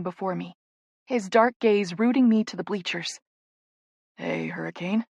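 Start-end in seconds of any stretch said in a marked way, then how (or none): 4.2-5.0 soft, lower greeting; with a smile. A narrator's reading. Caleb greeting the bride, soft fond greeting. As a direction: say her nickname tenderly, softly. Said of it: "he says with a smile".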